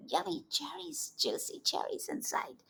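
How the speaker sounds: hushed, secretive whisper; soft tempting whisper about juicy cherries. Acted.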